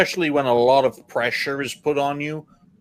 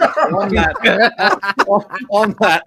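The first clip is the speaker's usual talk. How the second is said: laughing; 5 semitones higher, 5 dB louder, much slower than usual. Real, not acted. Laughing while changing subject.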